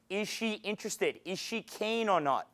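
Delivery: ordinary delivery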